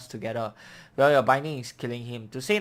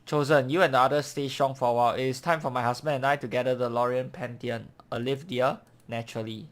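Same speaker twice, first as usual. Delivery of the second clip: normal talking voice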